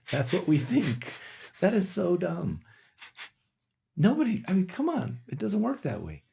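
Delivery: high-pitched, incredulous outburst; exasperated disbelief. Real, not acted. Clinician mocking how doctors think, exasperated and high-pitched. Scoffing at doctors' own attitude.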